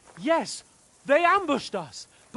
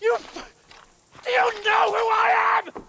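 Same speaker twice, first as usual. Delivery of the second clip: angry shouting; 8 semitones higher than usual. Acted. Arguing with guards at the gate.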